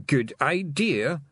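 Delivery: slow, dawning grin in the voice; slowly. Acted. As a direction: stretch it out as the idea sinks in. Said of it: "he said slowly".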